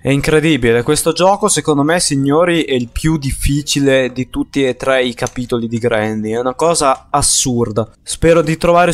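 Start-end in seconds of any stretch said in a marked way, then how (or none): none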